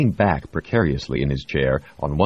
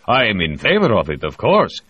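higher, louder keen Russian delegate; eager Russian official; 6 semitones higher, 6 dB louder than usual. A narrator's reading.

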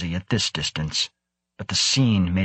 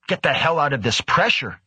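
higher, urgent snap; snapped retort; 6 semitones higher than usual. A narrator's reading. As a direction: snap back in alarm. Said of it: "Egon snapped".

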